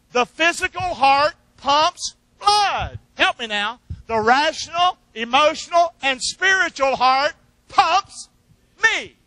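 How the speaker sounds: slow, loud preaching cadence; preacher hammering home his point, loud and slow; preaching at full force. Shown as a demonstration. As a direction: preach it out, building to a shout. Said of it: "Could you say that with me?".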